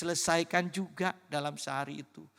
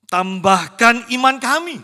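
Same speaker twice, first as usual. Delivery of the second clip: loud plea; 6 semitones higher, 12 dB louder than usual. Retold or imitated. Disciples' plea quoted in unison.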